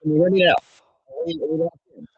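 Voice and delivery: muffled, breaking-up call audio; muffled wah wah talk. Real, not acted. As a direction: sound like the Peanuts teacher. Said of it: "You're very muffled".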